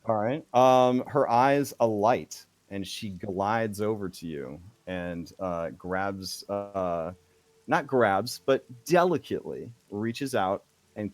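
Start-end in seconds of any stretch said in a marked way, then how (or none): none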